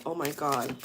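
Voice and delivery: normal talking voice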